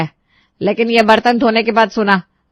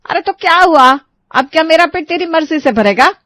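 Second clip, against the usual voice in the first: put-on high, loud scolding old woman; mother-in-law scolding the bride, indignant; sharp mother-in-law; 9 semitones higher, 8 dB louder than usual. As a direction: snap back indignantly. Sushila snaps back.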